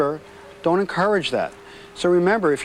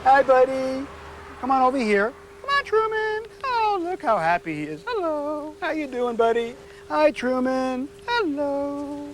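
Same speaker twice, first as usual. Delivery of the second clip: high, sing-song voice for a dog; 8 semitones higher, slower than usual. Real, not acted. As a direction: coo to the puppy to win him over. Trainer greets the rescue poodle.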